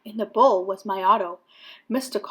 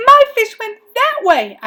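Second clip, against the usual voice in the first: high, loud and urgent; urgent call; 11 semitones higher, 9 dB louder than usual. A narrator's reading. Boy pointing policeman toward his fish, urgent high voice. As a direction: send him the right way, urgently.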